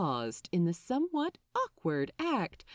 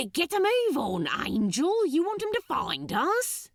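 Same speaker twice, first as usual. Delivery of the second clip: louder, higher, urgent demon; urgent Crowley; 7 semitones higher, 4 dB louder than usual. Acted. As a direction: hurry him up, half laughing. Crowley hurrying Aziraphale.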